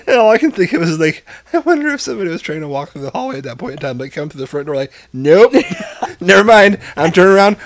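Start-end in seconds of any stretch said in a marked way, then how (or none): 5.1-7.6 mock horrified stranger. Imagined stranger fleeing the smell.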